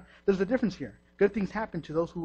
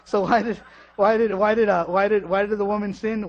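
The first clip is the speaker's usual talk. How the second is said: louder and higher stammering question; rising preacher's cry; preaching loud; 4 semitones higher, 8 dB louder than usual. Real, not acted. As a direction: build the question up from the pulpit.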